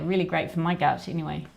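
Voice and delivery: ordinary talk, nothing marked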